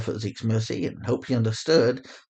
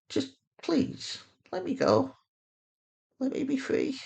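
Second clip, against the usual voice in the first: higher woman's voice, urging then begging, last plea nearly whispered; Sanaya pleading; 14 semitones higher than usual. Acted. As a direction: lift the pitch and plead to be let go.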